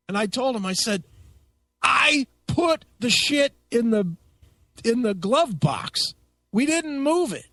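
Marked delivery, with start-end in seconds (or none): 1.8-7.5 high, slow, emphatic retold protest; losing his temper. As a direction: spell it out indignantly, word by word. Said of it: "I fucking lost my goddamn mind".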